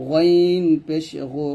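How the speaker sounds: slow, long গইন rasped at the top of the throat; throaty গু, not a plain g; sound from throat's end. Shown as a demonstration. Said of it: "গইন খ গলার শেষ থেকে উচ্চারণ করব". (গলার শেষ থেকে টেনে গইন পেশ গু)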